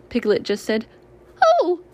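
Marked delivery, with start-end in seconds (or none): 1.4-1.8 higher, louder little gasp of joy; tiny piglet's surprised Oh. A narrator's reading. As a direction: squeak it out overcome. Piglet overwhelmed.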